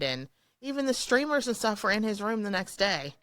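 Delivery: ordinary delivery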